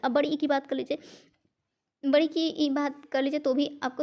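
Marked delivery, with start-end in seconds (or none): none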